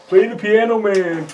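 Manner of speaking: high, loud mock call of a bar patron; rowdy fan request. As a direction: holler it like a fan in the crowd. Fans shouting requests at gigs.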